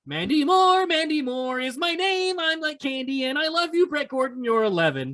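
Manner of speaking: lightly voiced, high pop star; a hint of a sweet pop idol. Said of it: "walked onto the T R L stage and said".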